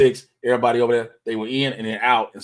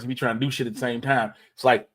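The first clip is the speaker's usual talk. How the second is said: usual speaking voice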